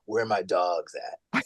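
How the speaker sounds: slow, soft, soothing take on a shout. Retold or imitated. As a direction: croon the shouted line like a lullaby. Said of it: "it'll be like".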